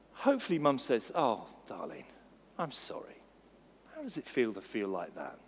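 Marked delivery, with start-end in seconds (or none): 1.1-5.4 lower, much softer comforting voice; tender mum. Retold or imitated. Mother in the story comforting her son, tender and soft. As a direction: soothe a hurt child gently.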